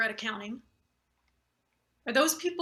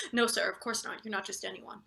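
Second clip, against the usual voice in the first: usual speaking voice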